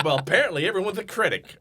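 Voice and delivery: dry comeback. Retold or imitated. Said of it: "I'm like".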